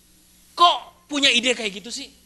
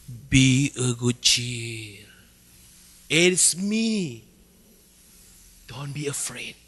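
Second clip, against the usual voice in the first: Jesus reassuring; 9 semitones lower, much slower than usual. Acted. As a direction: reassure the frightened disciples gently. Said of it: "Yesus segera bilang begini". (Yesus menenangkan murid dengan lembut dan pelan)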